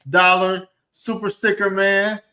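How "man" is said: ordinary talk, nothing marked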